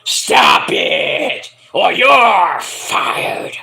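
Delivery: strained, drawn-out angry boss shout; Vince McMahon yelling. Pretend. Boss threatening to fire commentator, strained shout. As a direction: threaten him like a furious boss. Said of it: "And Booker's like".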